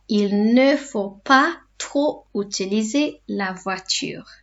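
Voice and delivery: slow, careful dictation pace; each word laid out for learners; slow teacher repeat; said slowly. Shown as a demonstration. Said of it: "say them slowly in between".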